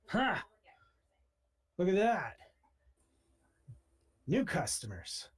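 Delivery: delighted gunsmith spotting new customers; gleeful greeting. Acted. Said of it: "he is like".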